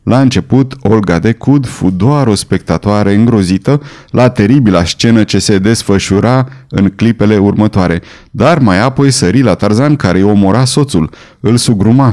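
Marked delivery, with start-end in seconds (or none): none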